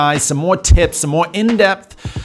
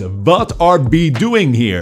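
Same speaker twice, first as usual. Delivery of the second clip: Dutch W like a V, slow; "What are we doing here?" with the W said like a V; Dutch accent demo; slower than usual. Shown as a demonstration. Dutch teacher demonstrating the W to learners, in a Dutch accent. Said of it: "So it's".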